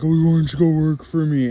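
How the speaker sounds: a light, put-on impression of his father; mimicking dad. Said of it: "and then my dad's like".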